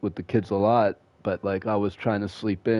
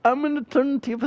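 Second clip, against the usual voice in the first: nagging mom; faster than usual. Retold or imitated. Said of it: "Eleven in the morning".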